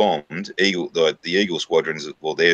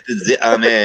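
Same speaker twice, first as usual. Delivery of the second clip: mock French accent, pitched up; 4 semitones higher, 4 dB louder than usual. Friend topping the other's attempt with a mock French accent. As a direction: parody the French accent with relish. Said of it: "That's my best French accent".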